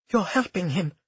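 pained. A narrator's reading. Cleo confronts Lucia over helping him, pained. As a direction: force out painful words. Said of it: "the words painful in her throat".

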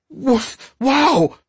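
very high recoiling yelp; startled exclamation. A narrator's reading. Deltan jerks back from the smell, high startled cry. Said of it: "jerked back and exclaimed".

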